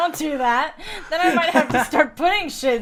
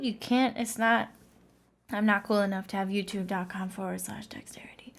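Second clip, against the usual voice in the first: low, hushed mumbled admission; sheepish; 5 semitones lower, 7 dB quieter than usual. Real, not acted. Podcast guest admitting he lacks his own YouTube link, sheepish. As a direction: drop right down, almost under your breath.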